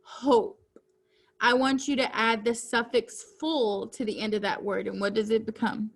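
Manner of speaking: ordinary delivery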